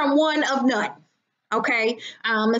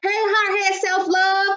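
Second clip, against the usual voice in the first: ordinary talk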